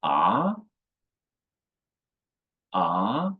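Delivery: "Ah" rising like a question; "Ah" with a Mandarin rising tone; second tone. Shown as a demonstration. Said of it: "The second tone is rising".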